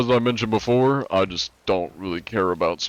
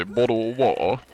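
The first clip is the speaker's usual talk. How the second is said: put-on British accent; 3 semitones higher than usual. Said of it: "when we hear British people say".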